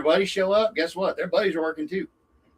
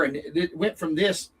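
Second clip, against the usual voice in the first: usual speaking voice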